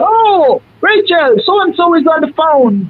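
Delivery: very high-pitched mock excitement; excited relative calling out. Girl's family answering his call, high excited imitation. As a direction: imitate a household member calling someone to the phone. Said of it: "They said".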